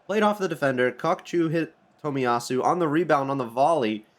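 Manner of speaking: ordinary talk, nothing marked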